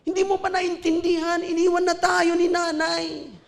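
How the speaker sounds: put-on high, pleading shout of a young girl; big sister crying out. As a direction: cry out as a desperate big sister. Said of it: "Sumisigaw na yung ate ko".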